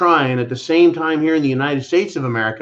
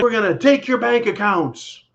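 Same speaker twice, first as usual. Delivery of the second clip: lightly voiced official, higher and threatening; a hint of a menacing bureaucrat; mock government threat; 6 semitones higher than usual. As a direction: sneer out the threats like a bully official. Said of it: "They're like".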